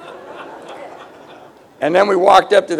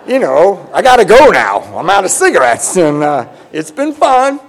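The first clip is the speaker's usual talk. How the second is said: mock excuse; 3 semitones higher, 8 dB louder than usual. Retelling his excuse to leave.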